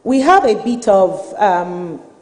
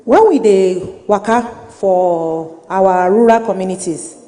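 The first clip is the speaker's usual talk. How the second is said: slow Nigerian Pidgin; 3 semitones lower than usual. Real, not acted. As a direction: speak it in Naija Pidgin. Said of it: "I'm going to speak in Pidgin".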